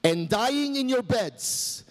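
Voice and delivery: a hint of Braveheart rallying his men, rising to a high shout; Wallace battle speech. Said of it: "Mel Gibson ... addressing his band of brothers".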